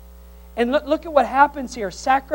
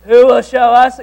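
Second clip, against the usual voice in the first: grumpy complaint; 13 dB louder than usual. Pretend. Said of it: "Could you say that with me?".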